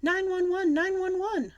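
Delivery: very high, loud cry for help; hollering. A narrator's reading. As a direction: holler for emergency help in a panic. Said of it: "I hollered".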